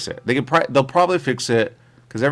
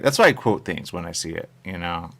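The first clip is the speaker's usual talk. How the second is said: normal talking voice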